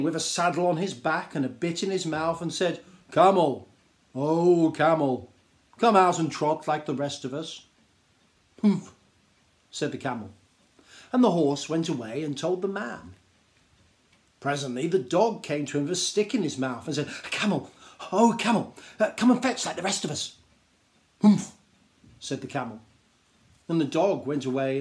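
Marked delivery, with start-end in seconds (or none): 3.1-7.6 calling horse. Acted. Horse calls out to camel. 8.6-8.9 grumpy camel humph. Acted. 17.3-20.4 eager dog. Acted. Dog calls out to camel. 21.2-21.5 sudden loud grunted humph; a camel's sulky snort. Acted. Camel's grumpy reply.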